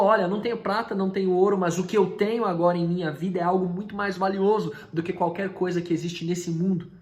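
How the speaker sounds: loud, high preaching voice; fervent proclamation. Acted. Pedro tells the lame man his treasure, loudly. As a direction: preach it out with fire.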